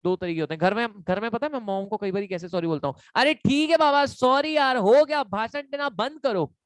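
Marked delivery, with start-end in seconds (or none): 3.2-6.6 irritated, higher and louder brush-off; annoyed sorry to mom. Shown as a demonstration. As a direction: wave off a lecturing mom, fed up. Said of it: "ये tone सही है? नहीं". (Mummy को चिढ़कर ऊँची आवाज़ में sorry)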